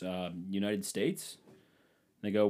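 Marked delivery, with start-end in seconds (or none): 0.0-1.4 slow, hesitant question to the border guard; lightly imitating the wife answering unsure, drawn out; imitating the wife's unsure answer, slow. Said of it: "And Dana goes".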